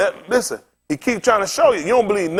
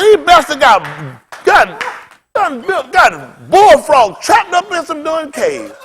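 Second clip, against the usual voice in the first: excited; 6 semitones higher than usual. Retold or imitated. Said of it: "I said".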